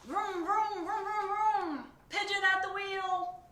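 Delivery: high, loud vroom-vroom play driving; loud engine vroom. Acted. Pigeon pretends to drive the bus, loud high vrooms. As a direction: roar the engine like an excited kid.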